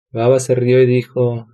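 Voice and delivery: ordinary delivery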